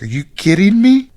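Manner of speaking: high, loud outraged confronting; shouting at a bro who disrespects his wife; indignant shout at bro. Retold or imitated. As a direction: call him out, furious and loud. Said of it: "I would look at my bro and be like".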